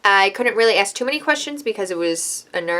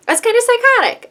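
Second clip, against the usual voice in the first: incredulous; 3 dB louder than usual. Real, not acted. Exclaiming about boyfriend.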